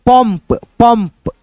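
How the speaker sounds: पंप slowly, with a clear m before the final p; careful teacher's पंप, said as "pamp"; dot read as M. Shown as a demonstration. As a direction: sound the nasal of पंप as म. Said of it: "म के रूप में आएगा".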